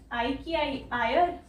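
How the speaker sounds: usual speaking voice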